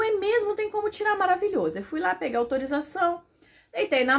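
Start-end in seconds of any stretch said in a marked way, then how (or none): 0.0-1.8 relieved. Retold or imitated. Her own relieved reply, voiced.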